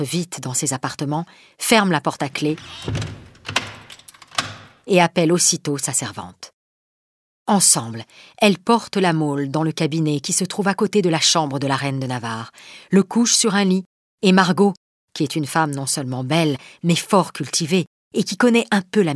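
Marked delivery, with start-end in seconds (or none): none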